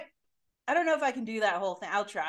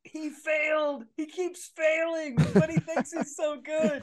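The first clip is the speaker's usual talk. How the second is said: high, slow sneering taunt; sing-song mockery of a failing impressionist; mocking jeer; 7 semitones higher, 3 dB louder, slower than usual. Mocking imitation of a heckler.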